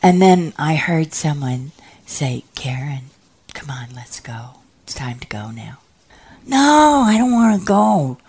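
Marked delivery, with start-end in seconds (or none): 2.5-5.8 soft, low, half-whispered urging; hushed retold summons; gentle coaxing. Acted gentle summons in story. 6.5-8.2 put-on high, louder childlike protest; retold stubborn objection; protesting refusal. Acted refusal to leave.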